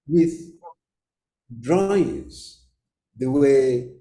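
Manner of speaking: normal talking voice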